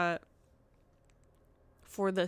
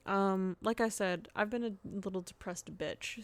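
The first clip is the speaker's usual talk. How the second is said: ordinary talk, nothing marked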